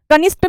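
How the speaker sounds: कनिष्ठ ending in plain 't', "kanisht"; wrong pronunciation. Shown as a demonstration. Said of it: "कनिष्ठ नहीं होता". (कनिष्ठ को 'कनिष्ट' बोलना)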